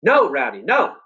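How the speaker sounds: high shout of no; yelling. A narrator's reading. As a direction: call the dog off sharply. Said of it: "I yelled".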